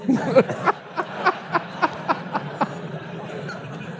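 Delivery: long high burst of laughter; hearty laugh. Real, not acted. Laughing at his own story about the accent. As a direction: let a big real laugh roll out.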